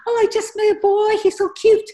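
put-on high, bright-eyed girlish gush; gushing teen girl. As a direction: gush like a giddy schoolgirl. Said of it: "if a girl was gonna say to me".

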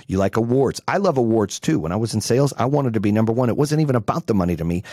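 ordinary delivery

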